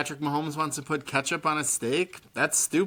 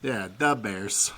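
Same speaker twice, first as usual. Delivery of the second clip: put-on Chicago accent; slower than usual. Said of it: "So that's how they say it in Chicago".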